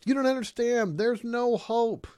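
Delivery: high, loud hopeless wail; mock the voice of someone who gave up; despairing doubter.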